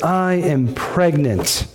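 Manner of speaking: slow and heavy, letting it land; grave slow delivery; slow, weighty repeat. Shown as a demonstration. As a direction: drop it slowly like a bombshell. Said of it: "That hit like a ton of bricks".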